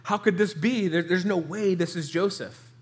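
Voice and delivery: high-pitched, incredulous imitation; utter disbelief. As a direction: voice the brothers' stunned disbelief. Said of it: "in complete and utter disbelief".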